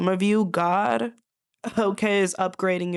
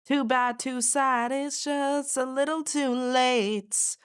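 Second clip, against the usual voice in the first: mocking singsong; 6 semitones higher, slower than usual. Real, not acted. Mocking dismissal of the doubters.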